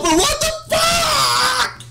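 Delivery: very high, long-held shriek; real incredulous rage; outraged scream. Real, not acted. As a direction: stretch the last word into a scream. Demanding answers from developer.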